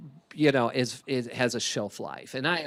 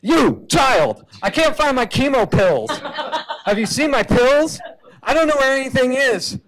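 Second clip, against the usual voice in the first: high, loud, frazzled voice hunting for pills; a foggy-brained patient panicking and yelling at the kid; confused chemo patient; 9 semitones higher, 8 dB louder than usual. Acted. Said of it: "cancer according to reality".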